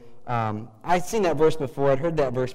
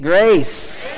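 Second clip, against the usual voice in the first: loud, higher, emphatic GRACE; like you mean it; 7 semitones higher, 12 dB louder than usual. Shown as a demonstration. Preacher leading the congregation's response, loud and wholehearted. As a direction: belt it out with conviction. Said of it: "Now say it like you mean it".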